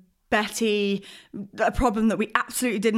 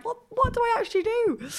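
much higher, bewildered plea; exasperated owner. Retold or imitated. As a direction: throw up your hands, confused. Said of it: "you're like".

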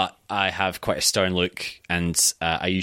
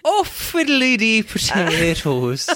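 high, drawn-out mock Irish accent; hammed-up, slow stage-Irish lilt; bad Irish accent; 12 semitones higher, 4 dB louder, much slower than usual. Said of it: "such a bad Irish accent".

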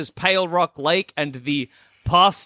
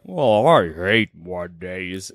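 very low, slow cartoon cat; fat, lazy voice, dragged out low; Garfield; 10 semitones lower, much slower than usual. Player imagining Garfield hit by a Monday, fat lazy drawl. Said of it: "if I played Garfield, he'd be like".

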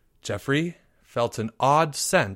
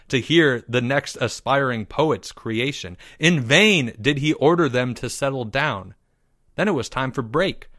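ordinary delivery, nothing marked

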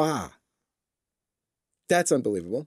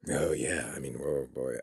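hesitant dodging reply; 12 semitones lower, 9 dB quieter than usual. Retold or imitated. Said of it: "most people would be like".

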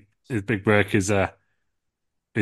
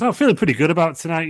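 higher and louder, hammed-up cheer; mock chirpy Triple H; 9 semitones higher, 5 dB louder than usual. Triple H arrives on Monday feeling good, chirpy put-on cheer. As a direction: chirp it like he's on top of the world.

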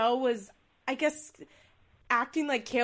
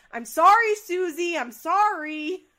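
much higher, louder whiny apology; mock sorry plea; 8 semitones higher, 10 dB louder, slower than usual. Son suddenly apologising to his mom, whiny.

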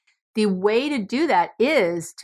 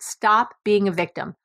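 ordinary talk

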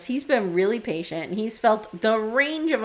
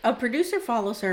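usual speaking voice

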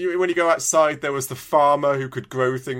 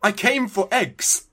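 slow, high-pitched exasperated protest; hammed-up complaint at being chatted to; mock exasperation; 7 semitones higher, slower than usual. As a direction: act out fed-up disbelief, drawing it out. Retold irritation at unwanted chat.

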